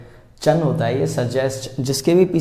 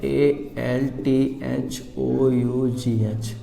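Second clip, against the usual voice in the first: letters spelled one by one, very slowly; slow spelling out; 2 semitones lower, 3 dB quieter, much slower than usual. Shown as a demonstration. Teacher slowly spelling although for students. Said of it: "जैसे although".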